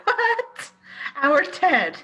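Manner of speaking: high, loud incredulous outburst; wife hears her friend is a detective, in loud disbelief; shocked disbelief. Acted. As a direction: react as if it's absurd. Wife hears Ted may be detective.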